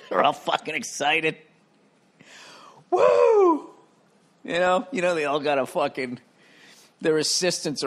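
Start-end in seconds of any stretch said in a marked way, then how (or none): 2.9-3.7 mock whoop. Owners cheer a playoff win.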